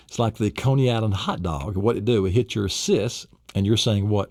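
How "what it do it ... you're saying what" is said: normal talking voice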